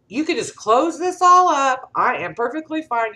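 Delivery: high, loud, stubborn brush-off; proud refusal. Retold or imitated. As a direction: wave the driver off, won't be helped. Dad refusing the van driver.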